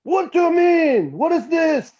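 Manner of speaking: high-pitched, slowed imitation of an outraged bandmate; retelling the vodka complaint, high mock outrage. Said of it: "and he was like".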